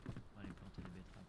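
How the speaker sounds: unvoiced whisper. Whispered repeat while planting beets. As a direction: whisper it like a secret.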